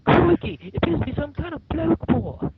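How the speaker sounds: a cartoonish squeaky Australian wildlife-host exclamation; Steve Irwin Australian accent. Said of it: "Steve Irwin's doing his".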